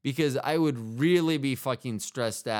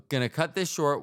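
ordinary talk

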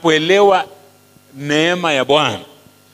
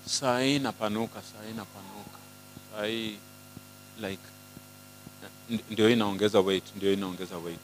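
soft, low, fretful murmur; muttering about gaining weight; hushed worried inner voice; 8 semitones lower, 15 dB quieter than usual. Retold or imitated. Mimicking feeling weight gain.

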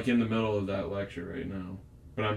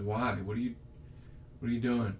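usual speaking voice

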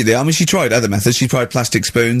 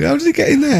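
mock innocent patient; 12 semitones higher than usual. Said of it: "say that to the doctor".

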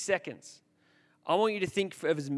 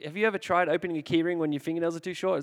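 ordinary talk, nothing marked

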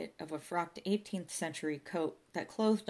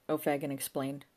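usual speaking voice

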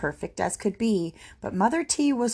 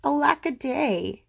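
high, woeful lament; crying out; 8 semitones higher than usual. Acted. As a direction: wail it lightly. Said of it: "And he cried".

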